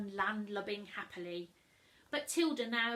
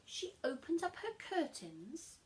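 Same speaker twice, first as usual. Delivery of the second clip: ordinary delivery, nothing marked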